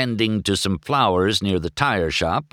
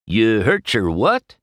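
confused; 4 semitones higher, 5 dB louder than usual. A narrator's reading. Mater confused.

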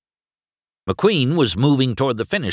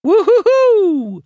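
very high, loud, slow whoop; triumphant hooting; 10 dB louder, slower than usual. A narrator's reading. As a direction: hoot like you've won it all. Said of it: "Chick was hooting in triumph".

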